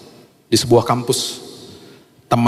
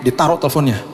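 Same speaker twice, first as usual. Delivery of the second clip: normal talking voice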